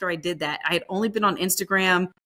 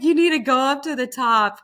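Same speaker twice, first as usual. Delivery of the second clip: higher, louder put-on voice for the Instagram guy; mentor's voice, redone; 6 semitones higher, 4 dB louder than usual. Instagram mentor from Alaska advising a newbie, in a higher, louder put-on voice. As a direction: play the Instagram mentor with a pushed-up voice. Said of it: "He's like".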